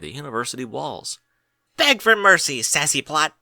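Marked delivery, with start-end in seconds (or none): 1.8-3.3 high, loud mock-fierce yell; playful battle cry. A narrator's reading. Dinky yelling at her friend in a headlock, playful battle cry. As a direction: bellow it like a foal winning a wrestle. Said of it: "Dinky cried".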